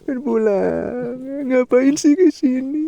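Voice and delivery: high, loud, slow mock wail; whiny wailing. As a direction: wail like a spoiled kid. Hamming up wanting to go home.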